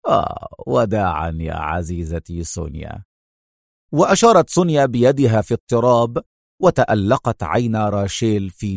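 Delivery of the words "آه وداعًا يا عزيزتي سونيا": much lower, softer mocking goodbye; sarcastic farewell. A narrator's reading. Adham answers Sonia's farewell, sarcastic. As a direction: tease her with the word. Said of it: "ابتسم ابتسامة ساخرة".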